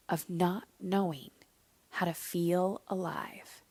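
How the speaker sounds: slow, soft and steady; calm inner voice. Retold or imitated. Inner voice gently answering her fear, slow and soft. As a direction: speak like a calm knowing inside. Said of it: "this little voice, this knowing".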